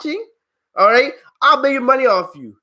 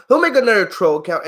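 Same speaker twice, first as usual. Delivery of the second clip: normal talking voice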